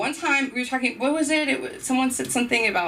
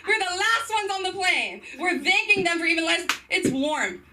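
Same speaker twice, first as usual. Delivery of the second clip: winding-down mock rant; 9 semitones higher than usual.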